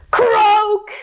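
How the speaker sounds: high, drawn-out frog croak. Pretend. Reader counting kids into a frog jump, high playful croak.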